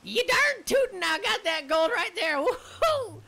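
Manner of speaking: put-on high, loud old gold miner voice; whooping old prospector striking gold. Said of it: "that's Old Prospector".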